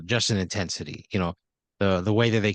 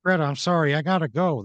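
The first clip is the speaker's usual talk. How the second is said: hurried apology as Michael; 6 semitones higher than usual. Acted. Acted hurried apology on leaving.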